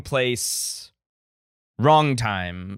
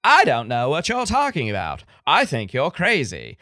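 put-on old-Hollywood Mid-Atlantic accent, swooping pitch. Shown as a demonstration. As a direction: demonstrate a Katharine Hepburn-era Mid-Atlantic accent. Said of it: "the Mid-Atlantic accent is like".

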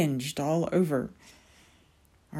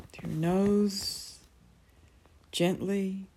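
guided meditation. Acted. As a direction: ease them gently into calm.

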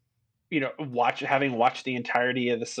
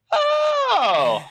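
much higher, loud, startled cry of "Oh!"; startled shout; 8 dB louder than usual. Retold or imitated. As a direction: yelp it as if caught off guard. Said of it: "and I was like".

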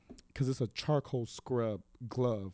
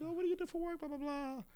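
much higher, breezy imitation of the doctor; mock doctor chit-chat; 9 dB quieter, faster than usual. Imitating the chatty doctor during surgery, high and breezy. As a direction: toss off the doctor's small talk up high. Voicing the doctor's small talk.

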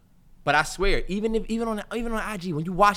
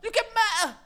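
very high, hammed-up British accent; Daniel Kaluuya; 13 semitones higher than usual. Podcaster imitates actor's movie speech, exaggerated British accent. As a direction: mimic a British film speech, pitched way up. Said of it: "mocking their accent".

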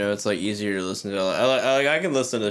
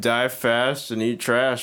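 slow, deliberate mock motto; slow deadpan sign-off motto; much slower than usual. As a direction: say it slowly like a creed. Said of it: "put out to anyone".

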